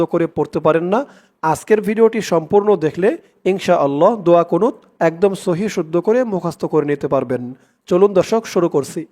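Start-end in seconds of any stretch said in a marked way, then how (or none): none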